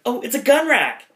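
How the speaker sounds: high, bright put-on girlfriend voice; cheerful girlfriend. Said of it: "she's like".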